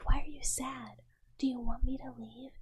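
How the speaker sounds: ordinary delivery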